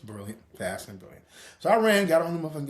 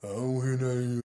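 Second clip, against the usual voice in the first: stern grandpa; 6 semitones lower than usual. Retold or imitated. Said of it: "he's like".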